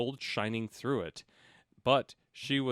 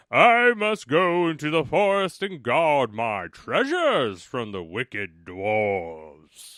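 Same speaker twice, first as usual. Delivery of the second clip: bear's voice; 9 dB louder, slower than usual. A narrator's reading. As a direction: boom it out slowly as a big friendly bear. Bear's speech continues.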